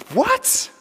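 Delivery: high-pitched, astonished objection; incredulous question. Acted. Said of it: "it always begs the question".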